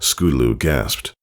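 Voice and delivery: ordinary delivery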